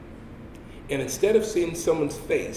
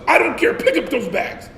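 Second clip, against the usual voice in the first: loud, higher barked order; sergeant yelling; 10 semitones higher, 5 dB louder than usual. Retold or imitated. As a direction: cut him off and bark it. Sergeant cuts recruit off.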